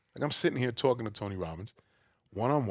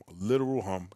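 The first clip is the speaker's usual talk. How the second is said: ordinary talk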